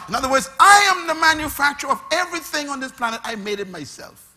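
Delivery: loud, quick, sing-song pulpit run; full-force preaching. Acted. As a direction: preach it with rising fervour.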